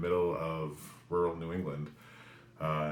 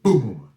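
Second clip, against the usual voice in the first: sudden loud boom, higher pitched; imitated boom; 4 semitones higher, 14 dB louder than usual. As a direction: burst out with a crashing boom. Sound effect of the crash in a story.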